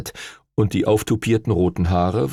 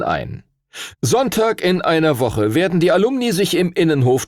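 solemn proclamation; 9 semitones higher than usual. Acted. Stuart Todd summons the tribunal over Henry, solemn proclamation. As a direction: proclaim it formally to the hall.

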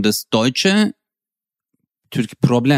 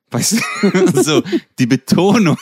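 ordinary talk, nothing marked

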